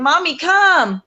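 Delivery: high, drawn-out call for Mommy; child calling mother. Retold or imitated. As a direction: imitate a child's insistent call, stretched out. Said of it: "like, that's his thing".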